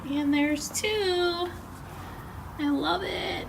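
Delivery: proud little reveal of the potted plant; delighted. Real, not acted. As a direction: show off the finished pot happily. Delighted at finished pots.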